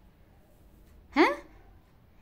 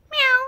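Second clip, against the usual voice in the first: mock meow; 9 semitones higher than usual. Owner meowing back at the cat.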